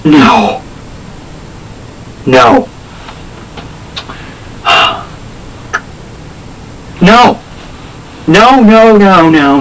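rising no. Real, not acted. Fan refusing the ending, rising protest. Denial at the fateful panel.

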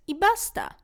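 high, insistent parental voice; a lightly voiced stubborn parent; firm parents. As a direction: put your foot down as the parent.

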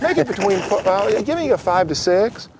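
high-pitched impersonation voice; Mike McKinnon. Acted. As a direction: do the impression high and put-on. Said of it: "sounds just like Mike McKinnon".

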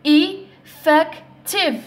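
"Effective" very slowly in three beats, e, fec, tive; split into syllables. Shown as a demonstration. Teacher demonstrating three sounds, "Effective" broken apart. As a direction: pause between each syllable of "Effective". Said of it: "رانا نسمعوا في ثلاث أصوات".

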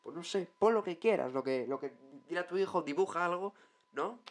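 high-pitched offhand executive reply; clueless club director. Acted. Huesca director delegating the mascot design, offhand. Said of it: "los directivos del".

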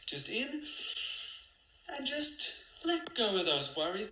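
high, strained soothing guide voice. Acted. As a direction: push the calm a bit too hard. Parody guided meditation.

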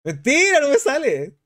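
high, incredulous protest; disbelieving exclamation. Real, not acted. Streamer told Pudú is trending, disbelieving exclamation. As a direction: refuse to believe the news.

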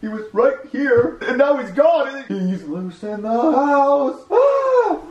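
high, slow wail building to a gasp; panicked. Acted. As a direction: draw out each word, climbing into panic. Babysitter finds child gone.

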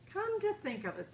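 lightly voiced mother. A narrator's reading. Mama voiced higher.

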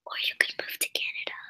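hushed, quick whisper. Real, not acted. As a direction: slip it in under your breath. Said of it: "What am I whispering?".

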